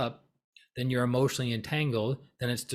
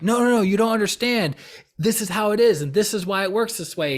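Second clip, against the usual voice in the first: loud, high, insistent arguing; all worked up; 8 semitones higher, 7 dB louder than usual. Retold or imitated. Arguing with someone who disagreed on pranic healing, all worked up. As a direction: re-enact heated insisting at high pitch. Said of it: "I'd be all worked up about it".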